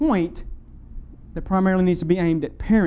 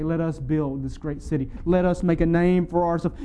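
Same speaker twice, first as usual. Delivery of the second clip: normal talking voice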